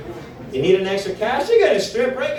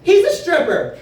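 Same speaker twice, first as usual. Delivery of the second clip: indignant lady announcing; 6 semitones higher than usual. Retold or imitated. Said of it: "She's like".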